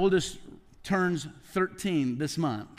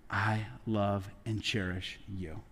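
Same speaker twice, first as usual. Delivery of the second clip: tender whisper of love; 10 semitones lower, 7 dB quieter, slower than usual. Acted. God's words to his people.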